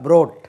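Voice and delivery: brought said short as "brot", loud, falling; model pronunciation. Shown as a demonstration. Teacher stresses brought loudly for the class. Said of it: "it is brought".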